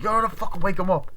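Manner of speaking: high, loud acted insistence; mock angry order. Friend demands someone wake the suspect, mock angry order. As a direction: insist in pretend exasperation. Acted quarrel over waking him.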